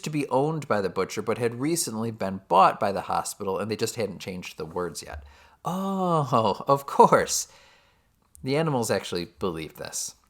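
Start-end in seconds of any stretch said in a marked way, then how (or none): 5.6-7.5 high, drawn-out sarcastic realisation; mock understanding. Narrator mocks Squealer's story about the van, sarcastic acceptance. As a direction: pretend to be convinced, laying it on thick. Mocking Squealer's outrageous excuse.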